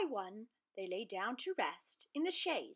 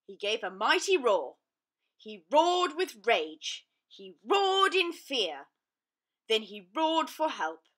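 dramatic narration; 8 dB louder, slower than usual. A narrator's reading. Narrating mighty roars.

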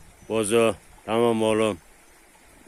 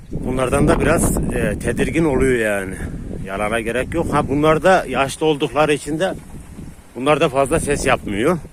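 louder over the dogs; 4 semitones higher, 5 dB louder than usual. Real, not acted. Explaining loudly to viewers over the dogs.

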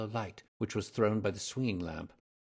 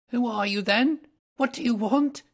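very high, loud, frightened demand; startled little man challenging a stranger; quavering voice; 11 dB louder than usual. A narrator's reading. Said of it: "asked in a quavering voice".

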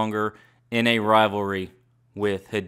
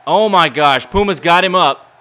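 excited; 5 semitones higher, 4 dB louder than usual. Real, not acted. Puma cutter on announce table.

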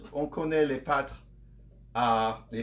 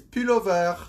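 "pullover" in a French accent; loud French-accented pronunciation of an English loanword; 6 semitones higher, 7 dB louder than usual. Shown as a demonstration. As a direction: say "pullover" as if it were a French word. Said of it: "You have to say it with a French accent".

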